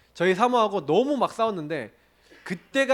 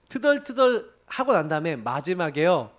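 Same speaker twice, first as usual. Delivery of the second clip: ordinary talk, nothing marked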